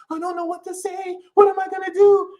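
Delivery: high, panicky mock celebrity; mock scared celebrity. Mocking scared celebrities in a high panic. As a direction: whine like a scared star. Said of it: "they're scared".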